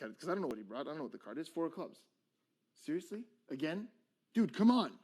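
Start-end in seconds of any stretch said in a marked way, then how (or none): 2.7-5.0 high, rising disbelief; exasperated. Shown as a demonstration. Magician fed up with his assistant's repeat miss, exasperated. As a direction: build from disbelief to loud exasperation. Acted exasperation at wrong card.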